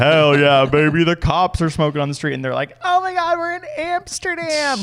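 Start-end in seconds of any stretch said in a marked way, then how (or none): 0.0-2.3 proud local, imitated a bit higher; mock proud. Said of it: "so proud". 2.8-4.8 very high mock squeal of excitement; imitated thrilled tourist.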